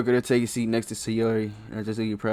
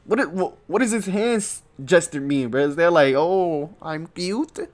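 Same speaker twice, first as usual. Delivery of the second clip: loud, higher confused outcry; baffled loud bro; 7 semitones higher, 5 dB louder than usual. Real, not acted. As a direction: shout your confusion at the screen.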